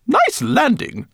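squeaky high rat lady; self-satisfied high rat voice. Acted. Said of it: "heard her comment".

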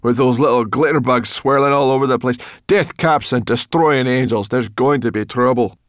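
fed-up, agitated complaint; sarcastic exasperation. A narrator's reading. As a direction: rant with heavy sarcasm. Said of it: "Sorrel rolled her eyes".

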